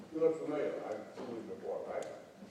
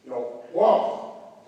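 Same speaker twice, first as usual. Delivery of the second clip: a loud slow whomp like a frog gulping air; 5 semitones lower, 8 dB louder, much slower than usual. Shown as a demonstration. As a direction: mimic a frog's big gulp of breath. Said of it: "how many of you have seen a frog breathe".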